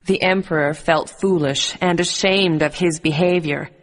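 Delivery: usual speaking voice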